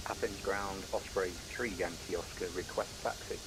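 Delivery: loud radio call; crackly cockpit radio transmission; over a pilot headset. Real, not acted.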